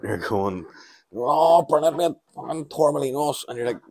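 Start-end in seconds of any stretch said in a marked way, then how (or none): 1.1-3.4 high, loud mock drunk; hammed-up drunk holidaymaker hollering to a mate; rubber-legged drunk. Drunk traveller calling his mate at airport, loud slurring drunk. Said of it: "all these rubber men walking through the airport".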